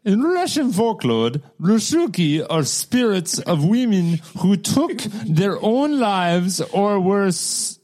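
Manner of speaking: bad Russian accent reading folklore; mock Russian accent. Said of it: "I can't do a Russian accent".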